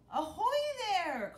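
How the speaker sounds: high, loud pirate hail from above; calling out. Acted. A pirate hails the captain from the tree, calling out. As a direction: hail them from the rigging. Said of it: "called a voice".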